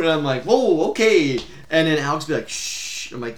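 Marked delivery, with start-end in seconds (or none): 0.4-1.4 higher and louder, caught off guard; startled whoa. Retold or imitated. Retelling his reaction to the alarm, startled. 2.5-3.1 a breathy unvoiced shush. Alex hushing the group, whispered shush.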